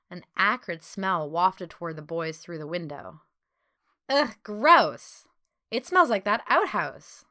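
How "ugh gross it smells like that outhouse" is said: grossed out, higher voice; loud, higher-pitched "ugh" of disgust. Acted. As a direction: recoil from a nasty stink. Disgusted at an acrid smell.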